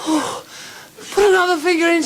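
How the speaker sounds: very high, loud and quick; woman in ecstasy. Acted. Woman urging her lover on, high excited cry. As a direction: cry out, egging him on.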